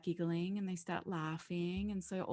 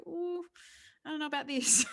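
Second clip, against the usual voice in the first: hesitant reluctance; 9 semitones higher, 5 dB louder than usual. Retold or imitated. People faced with looking at their own stories, mock reluctant and high. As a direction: pull back as if unsure. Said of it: "most people go".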